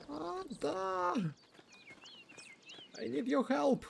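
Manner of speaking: high, pleading calls; calling out. Acted. Andreas begs Otto for help digging, high pleading call. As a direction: yell for help across the fields.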